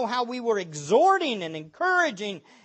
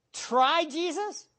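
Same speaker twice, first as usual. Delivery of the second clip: "Try Jesus" slow and higher with a rising disbelief; incredulous repeat; 7 semitones higher, slower than usual. Real, not acted. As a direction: echo "Try Jesus" in disgust.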